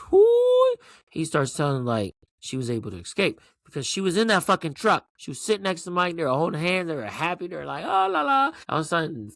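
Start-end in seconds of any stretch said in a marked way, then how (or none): 0.1-0.7 very high, loud whoop; excited whoop. Retold or imitated. Cheers at the revelation. 7.8-8.5 high, lilting la-la; mock happy singsong. Mimics the happy couple.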